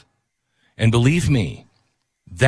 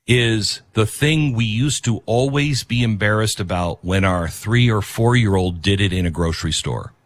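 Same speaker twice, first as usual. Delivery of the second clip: ordinary talk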